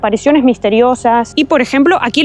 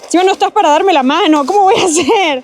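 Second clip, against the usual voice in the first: scared; 3 semitones higher than usual. Real, not acted. Nervous hiker begging her companion for a hand, scared. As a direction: plead nervously, afraid of falling. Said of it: "que me da miedo".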